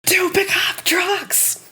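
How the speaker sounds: high breathy stage whisper-shout; slow, hushed, excited yell. Pretend. Absurd joke exclaimed.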